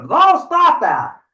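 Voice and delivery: high, drawn-out troll protest; exasperated troll. Acted. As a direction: wail it slowly in frustration. Troll cuts off the goat's wordplay.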